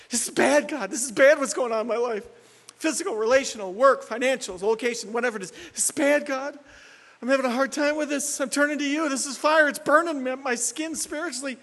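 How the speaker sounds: high, strained pleading; distressed prayer. Acted. Preacher acting a sufferer telling God of the trial, distressed. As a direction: plead urgently under crushing pressure.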